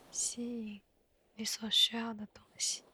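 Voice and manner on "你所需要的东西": ordinary talk, nothing marked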